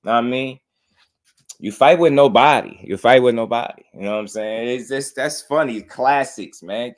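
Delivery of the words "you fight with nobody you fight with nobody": loud mock Mexican accent; Canelo accent impression. Streamer quoting a boxer's taunt for laughs, loud Mexican accent. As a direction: belt out the taunt in playful Mexican-accented English. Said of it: "Canelo is a funny guy".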